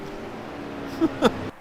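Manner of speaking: airless high laugh; breathy laugh. Real, not acted. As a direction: burst into a silent laugh. Laughs at the spin.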